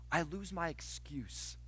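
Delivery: slow, soft confession; quiet admission. Shown as a demonstration. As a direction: let it sink in quietly. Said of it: "do you know what you lose?".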